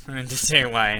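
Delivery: ordinary talk, nothing marked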